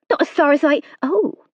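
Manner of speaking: a frightened country maid pleading her case; nervous serving girl. A narrator's reading. Hilda voice held.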